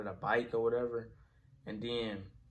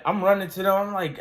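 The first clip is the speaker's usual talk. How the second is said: ordinary delivery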